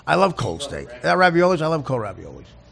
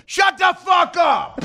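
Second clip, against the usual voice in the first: imitated yell at a dog; 12 semitones higher, much slower than usual. Host imitating Levy screaming at his barking dog, drawn-out yell. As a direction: yell it slow like a fed-up dog owner. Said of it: "when he's home is like this".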